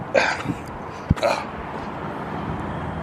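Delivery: long tired unvoiced sigh; weary sigh. Real, not acted. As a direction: let out a long weary breath. Searching under the car.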